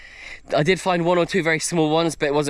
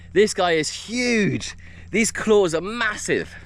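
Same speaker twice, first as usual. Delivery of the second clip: slow, higher-pitched, drawn-out amazement; awed excitement; 4 semitones higher, slower than usual. Real, not acted. Showing viewers the massive claws, awed. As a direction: stretch out the words in wonder. Shows off caught crab.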